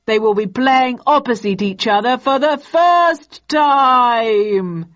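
stage announcer. Acted. Announcing the bicentennial to a theatre audience, grandly. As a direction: draw out the last words for the crowd.

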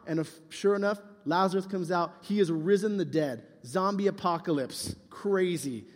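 normal talking voice

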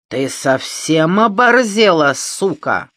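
громкий злой медленный окрик; рявкнуть с угрозой. Acted. Бандит орёт на Маню. (злобно орёт)

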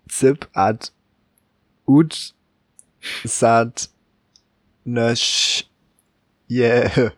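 "Zip at ut sat nösch" sounded out reversed, word by word; halting reversed words with pauses; speaking backwards. Shown as a demonstration. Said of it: "Ich möcht's auch mal versuchen".

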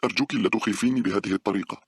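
shaken, pleading voice; frightened plea. A narrator's reading. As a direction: beg her friend to stop scaring her. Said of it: "قالت باولا خائفة".

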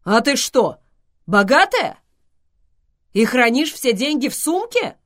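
loud, high astonished question; surprised. A narrator's reading. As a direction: ask in loud amazement. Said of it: "удивился Зевс". (громко удивиться)

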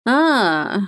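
mildly offhand; dismissive. A narrator's reading. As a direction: wave the question away. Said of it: "отмахнулась миссис Педрейк".

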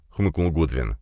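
plain reading voice

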